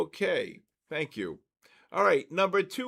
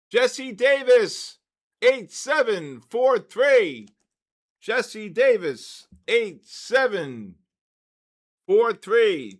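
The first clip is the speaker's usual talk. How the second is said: loud, slow, higher call across the room; 5 semitones higher, 9 dB louder, slower than usual. Real, not acted. Host announcing the winning name and ticket to the room, loud slow call. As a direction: project the name and ticket slowly to the crowd. Host calling out raffle winner.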